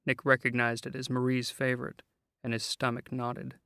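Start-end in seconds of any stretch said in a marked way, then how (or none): none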